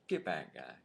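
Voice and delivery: low, muted brush-off; quietly telling someone to back off. Retold or imitated. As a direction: wave off a pushy stranger, low. Mimes brushing off card flippers.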